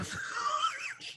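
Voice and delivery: high squealing laugh; real laugh bursting out. Real, not acted. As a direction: crack up laughing. Laughs at the story.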